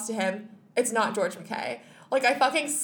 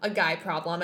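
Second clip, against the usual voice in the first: ordinary talk, nothing marked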